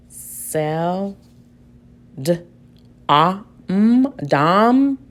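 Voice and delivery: "Sel, d, ah, mm, dom" sound by sound. Shown as a demonstration. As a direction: tap out each sound, "dom" like "dahm". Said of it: "Oh, let's try the schwa".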